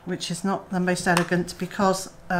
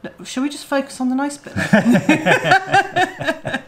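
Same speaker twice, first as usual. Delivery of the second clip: laughing; 7 semitones higher, 4 dB louder, slower than usual. Real, not acted. Teasing reply, laughing through it.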